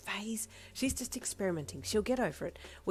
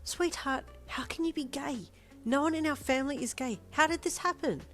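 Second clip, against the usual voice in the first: much higher, aghast voice; a mother horrified and bewildered; high-pitched disbelief; shocked dismay; 10 semitones higher, 3 dB louder than usual. Acted. Mum shocked by daughter's news.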